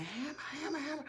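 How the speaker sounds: ordinary talk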